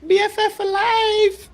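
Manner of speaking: put-on high, bubbly valley-girl squeal; Malibu girl. Mocking the man as a Malibu girl gushing about her BFF. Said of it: "You sound like one of the Malibu girls".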